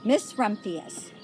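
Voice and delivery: ordinary delivery, nothing marked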